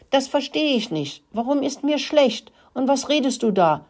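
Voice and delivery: high-pitched, plaintive wail; whining lament. A narrator's reading. Sick rat Rudi doesn't understand, high whiny complaining. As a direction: moan pitifully, pitched well up. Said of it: "jammerte Rudi".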